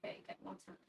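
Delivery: whispered under the breath; whispered to self. Real, not acted. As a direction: whisper it to yourself. Muttering to self.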